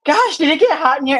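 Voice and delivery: a hammed-up startled gasp of a question; flustered client. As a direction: play a client caught off guard by sudden heat.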